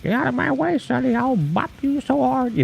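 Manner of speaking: put-on high feisty old lady voice. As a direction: threaten like a cranky old lady. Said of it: "even the old ladies are like".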